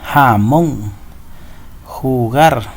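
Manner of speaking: Jamón and Jugar, each leaning on the final syllable, MÓN, GAR; stress on last syllable. Shown as a demonstration. Teacher modelling marked syllables for pupils, Jamón and Jugar stressed at the end. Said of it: "la mayor fuerza de voz se hace en la que está marcada".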